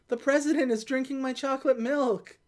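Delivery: put-on little kid's complaint; mock childish whine; whining kid. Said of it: "And the kid goes".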